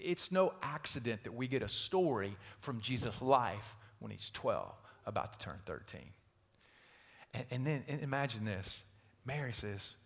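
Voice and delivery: ordinary talk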